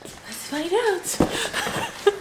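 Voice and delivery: very high, slow and drawn out; sing-song reveal tease before opening a box; playful teasing singsong. Real, not acted.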